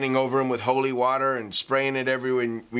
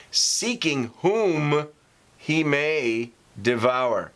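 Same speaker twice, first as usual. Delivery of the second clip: drawn out, a pause before "he may devour"; slow, pausing; 3 semitones higher, much slower than usual. Shown as a demonstration. Preacher reading the verse aloud, slow and deliberate. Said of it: "I read that slowly with pause for emphasis".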